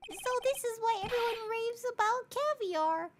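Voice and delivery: squeaky high judge voice; high-pitched old judge. Acted. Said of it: "It's now canon the judge has a high-pitched voice".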